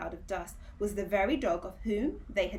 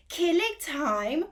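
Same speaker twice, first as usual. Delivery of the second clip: higher, louder, slow furious roar; 8 semitones higher, 7 dB louder, slower than usual. Acted. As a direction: roar the words back in outrage. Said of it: "roared the dog".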